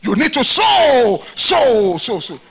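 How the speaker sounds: loud, drawn-out chanting of sow; mock preacher shouting. Mocking a greedy pastor pushing members to give, loud chant. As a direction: bang out each sow like a fundraising pastor. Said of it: "I say".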